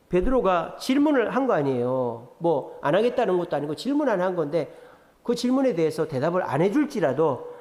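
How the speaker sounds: ordinary delivery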